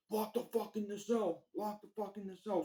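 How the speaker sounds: guard yelling at inmates, imitated; mock scream. Guard ordering inmates to lock in, mock yelled.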